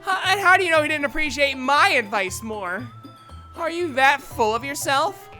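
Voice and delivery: high, loud indignant outburst; angry challenge. Acted. Natsuki challenging Yuri about Hayate's opinion, angry. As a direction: throw the accusation back loudly.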